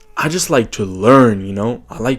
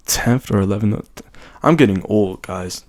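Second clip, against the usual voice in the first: normal talking voice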